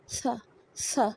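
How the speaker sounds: सा, सा slow and soft, air between the teeth; soft airy sa. Shown as a demonstration. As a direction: breathe out a soft सा. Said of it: "Place the tongue between the front teeth". (नरमी से हवा के साथ सा)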